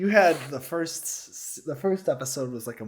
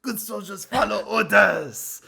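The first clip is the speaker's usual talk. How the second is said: high, slow mock French soldier; French accent; 8 semitones higher, 4 dB louder, slower than usual. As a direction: drawl it like a French sniper. Crosshair as French sniper.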